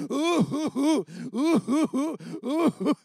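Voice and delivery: imitated heaving sobs. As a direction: sob in gulps. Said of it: "So now I'm heaving".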